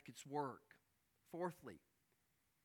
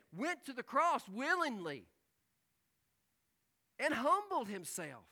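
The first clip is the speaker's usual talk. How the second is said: loud, high sermon build; preaching at full force; 8 semitones higher, 11 dB louder than usual. Acted. Preacher proclaiming Christ's humility, loud and rising. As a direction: swell to a climax.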